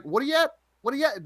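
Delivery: high-pitched Newfoundland greeting; Newfoundland accent. Shown as a demonstration. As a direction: lay on your old Newfoundland accent. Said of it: "when I used to say that".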